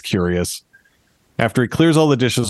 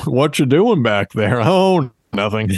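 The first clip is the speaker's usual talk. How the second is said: stupid horse voice; 2 semitones higher than usual. Retold or imitated. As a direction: draw it out like a dim-witted horse. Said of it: "turns out horses are stupid".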